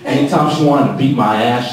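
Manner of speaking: ordinary delivery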